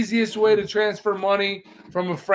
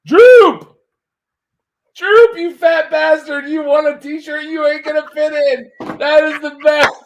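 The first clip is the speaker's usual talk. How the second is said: loud, high cheering at the winner; excited shout; 10 semitones higher, 9 dB louder than usual. Real, not acted. Host cheering the winner on the stream, loud and thrilled. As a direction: yell his name in delight.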